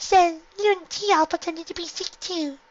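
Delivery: put-on high, slow child voice; little girl voice. A narrator's reading. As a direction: speak like a small child. Little sister explains.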